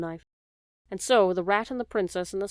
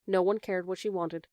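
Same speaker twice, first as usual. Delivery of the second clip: ordinary delivery, nothing marked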